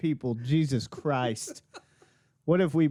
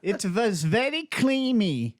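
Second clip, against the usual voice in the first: fumbled mock German accent; a bad German accent attempt; hammed-up mock German, slow; 6 semitones higher, slower than usual. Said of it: "I messed that voice up".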